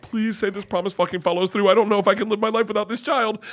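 high, rushed, panicked begging; frantic high-pitched pleading; desperate plea. Retold or imitated. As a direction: plead as a terrified parent, acted out. Retold desperate plea for his child.

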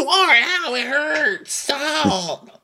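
high, slow, whimpering mock pain. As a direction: whimper like a hammed-up victim. Mocking Catherine's whining.

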